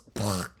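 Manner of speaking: short sputtered explosion sound; mouth-made blast for an overreaction; imitated blow-up noise. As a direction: blow a mock burst through the lips. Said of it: "just blow it out".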